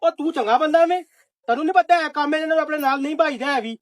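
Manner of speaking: high, loud angry dismissal; angry shout. Acted. Landlord shouting at his servant to go home, angry. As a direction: chase him out with a yell.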